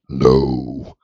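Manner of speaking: very low, flat possessed no; creepy no. Retold or imitated. Maskless mom turning like an exorcism, demonic low voice. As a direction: drop into a demonic voice. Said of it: "looks at you and goes".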